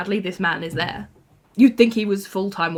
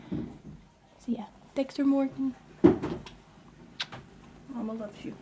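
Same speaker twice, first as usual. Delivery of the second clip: higher, hushed pet talk; 6 semitones higher, 8 dB quieter than usual. Real, not acted. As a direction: coo softly to your new kitten like a proud mum. Cooing to her new kitten.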